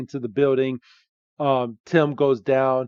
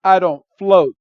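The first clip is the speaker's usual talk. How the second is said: alarmed Pikachu quote; 5 semitones higher, 8 dB louder than usual. Retold or imitated. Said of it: "Pikachu's like".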